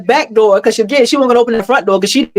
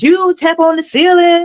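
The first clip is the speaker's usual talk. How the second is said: mock challenge; 9 semitones higher, slower than usual. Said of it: "He was like".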